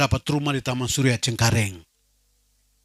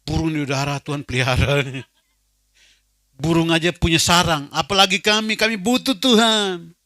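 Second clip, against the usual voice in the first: crying out; 3 semitones higher, 7 dB louder than usual. Retold or imitated. Man cries out in prayer that even birds have nests. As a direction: cry out to God, pleading. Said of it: "Saya berteriak".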